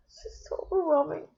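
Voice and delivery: overwhelmed, fighting back sobs; crying. Real, not acted. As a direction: voice breaking in tears. Breaking down while filming goodbye.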